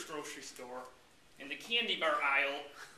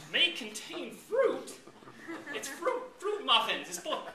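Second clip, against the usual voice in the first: squeaky high, slow disbelief; May contain fruit? rises far up in shock; high-pitched outrage at a label; incredulous; 9 semitones higher than usual. Acted.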